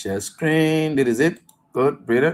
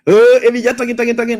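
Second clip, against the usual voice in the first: louder mocking impression of a dismissive critic; mock dismissive listener; 6 semitones higher, 4 dB louder than usual. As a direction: scoff at him as the critics would, loudly. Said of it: "you'll be like".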